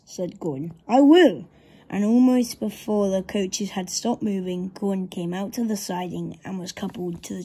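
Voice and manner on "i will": high, loud retort; emphatic retort. A narrator's reading. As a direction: fire it back loud, pitch swooping. Gordon's retort to cheeky Thomas.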